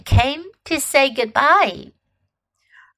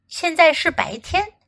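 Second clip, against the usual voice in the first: plain reading voice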